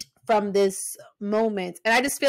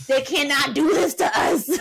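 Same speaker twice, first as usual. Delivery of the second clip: outraged yell; 3 semitones higher than usual. Retold or imitated. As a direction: yell it at the TV in disbelief. Reliving the match in outrage.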